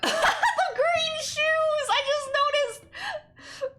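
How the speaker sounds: high squealing laugh through the words, slow. Real, not acted. Vlogger spotting green shoes mid-story, laughing hard. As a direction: burst out laughing at something just spotted. Sudden real laughing fit on camera.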